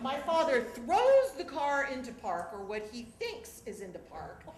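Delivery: ordinary delivery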